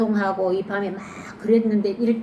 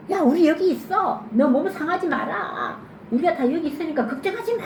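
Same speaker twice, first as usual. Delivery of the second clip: calling out; 8 semitones higher than usual. Acted. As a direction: call to him to calm down. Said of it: "하면서 막 소리를 꽤 질렀어요". (걱정 말라고 높게 외쳐 부르는 바울)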